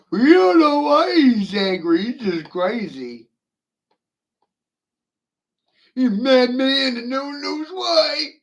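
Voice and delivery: high, loud, sing-song mimicry of clueless experts; mocking the experts. Mocking silent experts to his audience, high and loud. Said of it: "Play it dumb".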